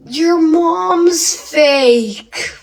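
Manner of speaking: high, very drawn-out taunt; teasing comeback. Pretend. Storyteller clapping back at commenters, mocking drawl. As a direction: stretch each word out like a childish jeer.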